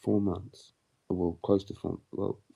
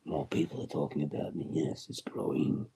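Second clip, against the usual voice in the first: lower, whispered power-hungry villain; mock villain; 5 semitones lower, 4 dB quieter than usual. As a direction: gloat over growing power. Villain gloating continues.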